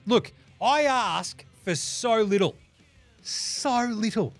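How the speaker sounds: high, slow, drawn-out fake plea; mock pleading. Host begging listeners for reviews, mock pleading. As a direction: stretch every word like a hard-done-by beggar.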